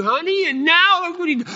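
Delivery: high, loud scheming queen; voicing Jezebel. Preacher as Jezebel scolding Ahab, high and loud. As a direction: Jezebel fussing at her husband, way up high.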